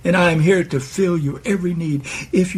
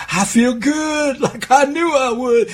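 higher, slower, a thrilled inner voice; excited; 6 semitones higher than usual. Acted. Listener answers Jesus from inside, excited and higher. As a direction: let the thrill lift the words. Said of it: "in your excitement".

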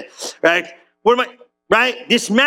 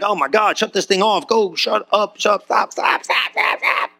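frazzled inner voice. Pretend. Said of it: "your head's like".

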